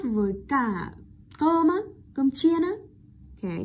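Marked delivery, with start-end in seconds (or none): 1.4-2.7 "cơm chiên á" pitched up as a query; higher voice checking on "cơm chiên á"; questioning intonation. Shown as a demonstration. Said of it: "mang ý nghĩa là câu hỏi". (giọng cao, hỏi lại "cơm chiên á")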